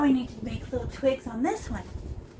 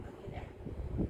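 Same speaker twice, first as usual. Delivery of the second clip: whispered; 9 dB quieter, slower than usual. Shown as a demonstration.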